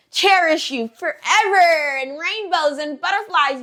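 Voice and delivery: high, slow, drawn-out gushing; mock the fairy-tale idea in a sing-song lilt; mock dreamy. Mocking people who idealize love spells, mock dreamy.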